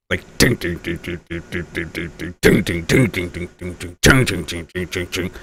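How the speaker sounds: low soft imitated bell dings; low quiet jingle bell effect; ding sound effect. Shown as a demonstration. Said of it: "Like timpanis".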